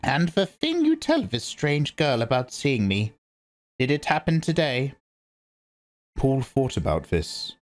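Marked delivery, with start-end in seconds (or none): 0.0-4.9 put-on raised, measured voice of a stern old matriarch; grave old priestess speaking; stern old Reverend Mother. A narrator's reading. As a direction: lecture her like a severe elder. Old woman probes Paul.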